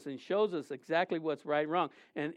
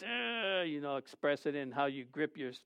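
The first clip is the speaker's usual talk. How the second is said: ordinary talk, nothing marked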